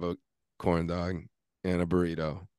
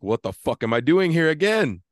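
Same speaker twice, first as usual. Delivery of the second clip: exasperated self-rebuke, higher and louder; angry at himself; 8 semitones higher, 7 dB louder than usual. Retold or imitated. Driver scolding himself in the car, angry and loud. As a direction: snap at yourself in disbelief. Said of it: "I was so angry with myself".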